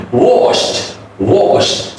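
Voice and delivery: 'Washed' as wosht; T ending. Shown as a demonstration. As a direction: end 'washed' on a light unvoiced T. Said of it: "final D will be the t sound".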